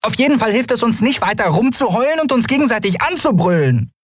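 high-pitched reproach; scolding Nelen. Acted. Nelen aiming a jibe at Keru, high scolding. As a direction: chide them in a small, high voice.

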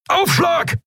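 a big bellowed warning, pitch jumping way up; bellowing. Acted. Helmsman warns crew of impact, bellowing. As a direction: yell it as the hit lands. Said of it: "brüllte Keru".

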